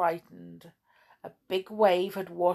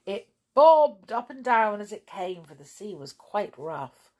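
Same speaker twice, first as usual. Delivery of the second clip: ordinary delivery, nothing marked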